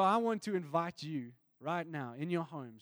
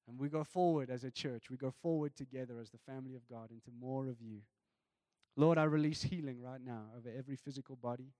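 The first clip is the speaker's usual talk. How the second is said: prayer; 3 semitones lower than usual. Acted. Closing prayer over listeners.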